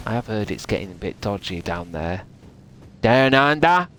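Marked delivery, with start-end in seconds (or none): 3.0-3.9 loud Aussie "down under", pitched up; broad Australian question; Australian accent. Acted. Streamer teasing an Aussie viewer, Australian accent. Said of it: "Or other Australian terms".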